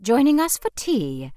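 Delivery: high, loud, over-friendly hostess; shrill, over-friendly. A narrator's reading. Hostess greeting a couple with shrill over-friendliness. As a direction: gush it at them like tourists. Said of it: "she asked in a shrill, over-friendly tone".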